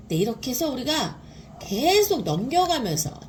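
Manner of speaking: ordinary talk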